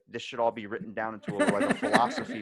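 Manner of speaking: laughing quote. Retold or imitated. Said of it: "and they, like".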